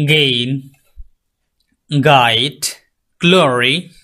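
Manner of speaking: গেইন, গাইড, গ্লোরি with a hard g; slow, careful example words; g sound words. Shown as a demonstration. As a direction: start each with a hard back-of-tongue g. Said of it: "for example".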